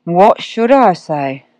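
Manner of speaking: slow, lazy drawl; unhurried drawling question. A narrator's reading. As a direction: stretch it out like a bored cat. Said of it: "drawled the cat".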